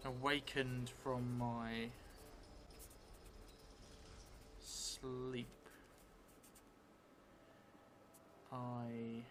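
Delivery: slow, drawn-out in-character telling, often hushed; slow story narration. Acted. Vampire player dictating a new memory to the audience, slow hushed narration. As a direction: spin the vampire's memory word by word.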